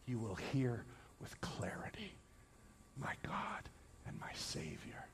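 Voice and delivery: very soft, low voice fading toward a whisper; hushed, tender preaching. Acted. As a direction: comfort the listener gently and quietly. Preacher drops to hushed tender close.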